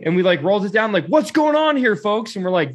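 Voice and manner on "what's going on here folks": very high, mock-official demand; challenging border guard. Guard questions travelers through open windows, high challenge. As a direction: confront them like a suspicious guard.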